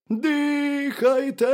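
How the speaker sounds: high, loud drawn-out hare; long stretched vowels, very high; hare calling out. A narrator's reading. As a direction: wail it slowly from the burrow. Hare calls from the burrow.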